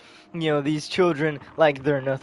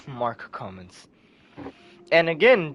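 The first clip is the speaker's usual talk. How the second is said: plain reading voice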